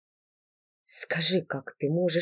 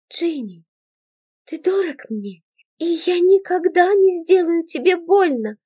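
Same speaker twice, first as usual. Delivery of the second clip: put-on girl promising she'll never harm him; tender reassurance; 9 semitones higher than usual. A narrator's reading.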